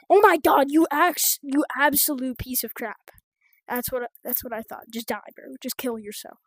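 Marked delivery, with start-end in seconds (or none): 0.1-3.1 loud, higher-pitched burst of anger; exasperated outburst. Real, not acted. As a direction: explode at the game in exasperation.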